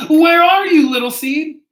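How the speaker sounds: high, loud searching call; storybook holler, pitched high. A narrator's reading. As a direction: call out for a lost friend. Fox calling for lost friend.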